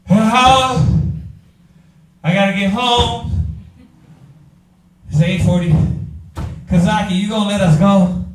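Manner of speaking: mock a tired student pleading to go home; impatient student mocked. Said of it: "That's how our people be".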